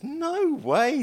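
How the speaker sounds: normal talking voice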